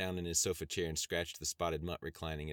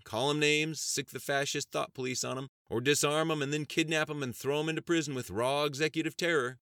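higher, louder retort with a grin; lighthearted retort; 9 semitones higher, 6 dB louder than usual. Acted. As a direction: toss it back grinning. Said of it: "Knight retorted with a lighthearted grin".

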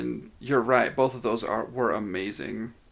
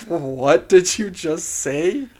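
slow, loud, higher mock outrage; appalled disbelief; 5 semitones higher, 7 dB louder, slower than usual. Imitating a friend regretting he knows the player, appalled. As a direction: stretch it out in horrified disbelief. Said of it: "deep regret for ever having known Travis".

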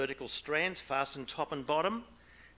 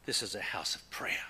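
hushed rebuke; 4 semitones lower than usual. Acted. Jesus in the temple, hushed.

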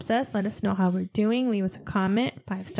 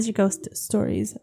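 usual speaking voice

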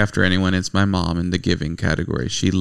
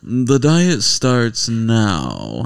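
very slow, lofty pig voice; sophisticated pig; 2 semitones higher, much slower than usual. Retold or imitated. Husband voicing the sophisticated pig in Peter Rabbit, lofty and slow. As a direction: pronounce it like a pompous pig. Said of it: "stands up and says".

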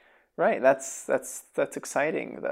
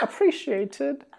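distant, drawn-out call from offstage; from another room; 12 semitones higher, much slower than usual. Pretend. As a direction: say it slowly as if from the next room. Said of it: "That's me from the other room".

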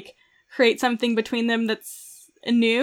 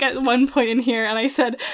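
usual speaking voice

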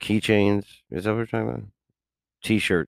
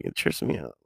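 normal talking voice

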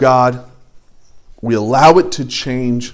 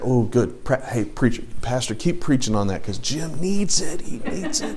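gloating whisper; 4 semitones lower, 10 dB quieter than usual. Retold or imitated. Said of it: "sitting there".

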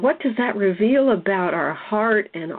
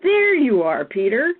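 higher-pitched movie-quote exclamation; quoted film line, voice lifted high; wondering recognition; 7 semitones higher, slower than usual. Retold or imitated. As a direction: exclaim it as if finally finding someone. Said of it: "looks just really intently into his eyes and goes".

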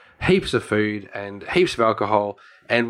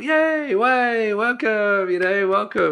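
high, excited mock welcome cheer; 10 semitones higher, slower than usual. French locals cheering the Australian visitors, high and excited. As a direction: cheer them in like a happy crowd. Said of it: "looked around and was like".

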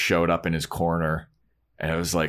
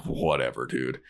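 eye-rolling dismissal; 7 semitones lower, 5 dB quieter than usual. Retold or imitated. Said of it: "rolls her eyes and she's like".

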